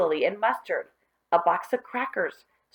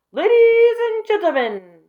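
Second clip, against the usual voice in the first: very high, loud, slow ringmaster call; announcer shout; 14 semitones higher, 9 dB louder than usual. Acted. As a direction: boom it out like a circus host. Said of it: "shouted Alan".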